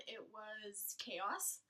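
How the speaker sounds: ordinary talk, nothing marked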